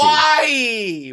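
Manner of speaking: laughing high exclamation about Hawaii; excited. Real, not acted. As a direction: burst out with delight.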